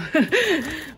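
surprised burst of laughter; loud laugh. Real, not acted. Owner laughing as her dog runs up.

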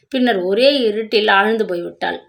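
plain reading voice

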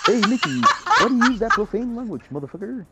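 Mickey voice. Pretend. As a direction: play the cartoon mouse, giggling. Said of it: "Mickey Croc here".

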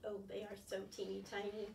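plain reading voice

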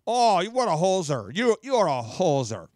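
mock-upset Minnesotan; Minnesota accent. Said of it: "upset with someone in Minnesota".

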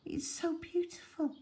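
soft whispered little voice; boy whispers in awe to the ranger; tiny voice. Acted. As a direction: barely breathe the words like a small child. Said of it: "Ali asked in a tiny voice".

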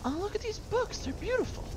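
very high, soft cooing voice; mock dainty admirer. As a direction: coo over books, hammed-up. Mock admiring books amid battle.

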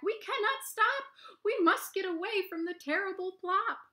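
high, loud breathless rabbits; rabbits crying back. Acted. Rabbits crying back to the fox as they run, high and frantic. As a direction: call it back high and panicky over your shoulder. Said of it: "the rabbits cry back".

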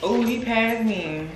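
usual speaking voice

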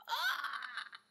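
high excited squeal with a laugh. Real, not acted. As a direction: squeal with delight. Squeal at the prize amount.

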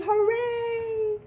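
put-on high, bright call for the kids to cheer; teacher leading the kids in a cheer, high and sung; cheering hooray. Shown as a demonstration. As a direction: cheer it out loud. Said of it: "Everybody say".

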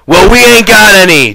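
loud, high-pitched furious outburst; sudden angry shout; angry explosion. A narrator's reading. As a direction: explode in exasperation. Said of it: "George exploded".

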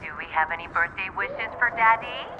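leaving a voicemail. Real, not acted. Mother's recorded voicemail message.

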